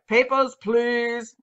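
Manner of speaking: slow, drawn-out mock German accent; bad German accent. Mock officer demanding documents at a police stop, bad German accent. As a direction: put on a terrible German checkpoint accent. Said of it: "not a German accent at all".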